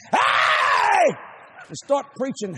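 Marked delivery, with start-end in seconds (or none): 0.1-1.1 much higher, louder sudden shout; preacher ready to jump up and yell in the pit; mock scream. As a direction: burst out with a yell. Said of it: "I was gonna just scream".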